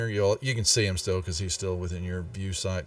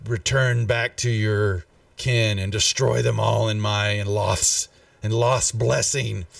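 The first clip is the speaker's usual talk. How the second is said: demonic voice; 3 dB louder than usual. Acted. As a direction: growl it like a demon lord. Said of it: "in this demonic voice".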